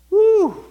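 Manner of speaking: high joyful preacher's whoop; exultant whoop. Real, not acted. Preacher whooping at the congregation, a loud joyful shout. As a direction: let out a loud joyful woo.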